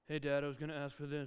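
low, soft mumble trailing into mush; preemptive grumbling. Retold or imitated. Kid asking dad while expecting no, mumbled grumble. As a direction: imitate a kid grumbling unintelligibly. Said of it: "it's the preemptive grumbling".

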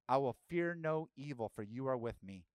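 normal talking voice

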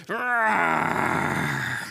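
long storybook monster roar. Acted. Coco Loco monster heard all of a sudden, roaring. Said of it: "hear the roar".

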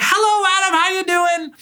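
high, beaming mock greeting; big emphatic hello. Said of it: "says with a big emphatic hello and a big smile".

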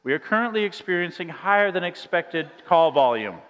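a caller quoting the hold message in a canned voice; recorded message. Retold or imitated. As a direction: read it like the automated recording. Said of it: "This famous line".

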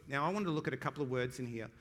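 ordinary delivery

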